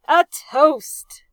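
loud, high roar of a toast. A narrator's reading. Drunk captain roaring a toast to his officers. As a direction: bellow it to the whole room. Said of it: "the captain roared".

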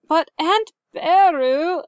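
high, drawn-out whine; child pleading with an aunt, loud whine; whining. A narrator's reading. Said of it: "Luke whined".

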